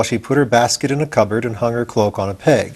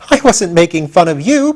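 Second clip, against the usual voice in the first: protesting; 6 semitones higher, 4 dB louder than usual. Acted. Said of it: "protested Tip".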